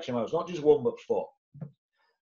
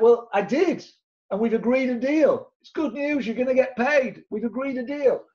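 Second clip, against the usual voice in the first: cheeky upbeat retort; 8 semitones higher, 3 dB louder than usual. Retold or imitated. Retorting to cross estate agents.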